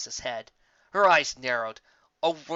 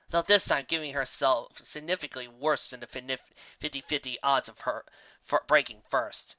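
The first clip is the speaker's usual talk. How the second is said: usual speaking voice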